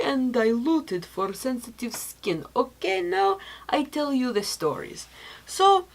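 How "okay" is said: ordinary talk, nothing marked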